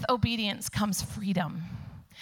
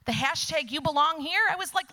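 ordinary talk

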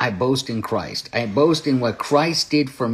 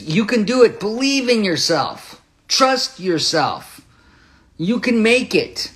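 high-pitched, rousing pep talk; motivational slogans; 9 semitones higher than usual. Retold or imitated. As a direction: imitate the world cheering someone on. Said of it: "These are great slogans".

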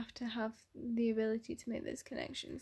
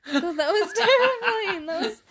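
laughing through the words, much louder; cracking up while talking; 7 semitones higher, 10 dB louder than usual. Real, not acted. Laughing at friend's giggle.

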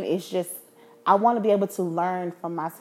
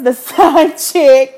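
slow, louder, laughing; 5 semitones higher, 11 dB louder, much slower than usual. Real, not acted. Laughing at her own admission.

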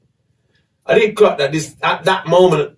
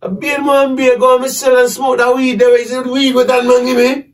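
angry shouting; 10 semitones higher than usual. Retold or imitated. To the man with power, a high furious shout. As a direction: push him off and shout, pitch way up. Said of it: "I kinda pushed him away now".

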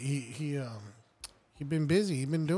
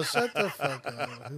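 playful slow dismissal; 2 semitones lower, much slower than usual. Retold or imitated. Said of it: "I was all".

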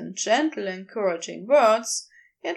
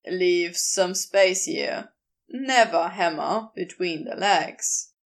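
lower, firmer man's voice; a lower Crowley, teasing and sure; Crowley's voice; 5 semitones lower than usual. Acted. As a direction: drop low and speak with easy authority.

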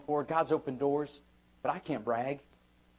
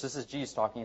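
ordinary talk

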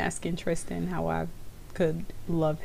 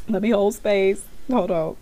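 usual speaking voice

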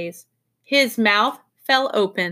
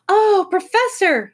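high, loud, drawn-out call; excited high-pitched cry to someone; 10 semitones higher, 6 dB louder, slower than usual. A narrator's reading. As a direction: call out to the professor, high and slow. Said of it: "she cried".

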